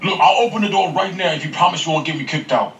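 usual speaking voice

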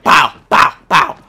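loud, punchy pows; imitated impacts. Gamer imitates kicking legs, loud punchy sound effects. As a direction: punch out each pow like a blow. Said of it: "the legs are like".

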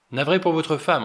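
loud, high booming condolence. Acted. General booming his sympathy to the butler. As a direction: boom it out across the table. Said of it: "d'une voix tonitruante".

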